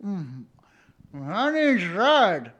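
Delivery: high, loud silly puppet voice; puppeteered dummy voice. Acted. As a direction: talk as the hapless puppet on someone's hand. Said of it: "I'll be puppeteering them and be like".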